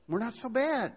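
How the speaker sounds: high, mocking shrug; mocking echo. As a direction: toss it off like a smug excuse.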